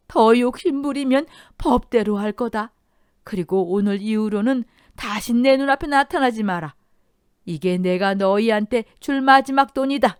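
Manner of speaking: high, loud, out-of-breath ultimatum; breathless trembling anger. Acted. Mother-in-law banishing her children, breathless angry ultimatum. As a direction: lay down the final warning, panting. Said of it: "숨까지 가쁘게 몰아쉬면서 떨리는 목소리로".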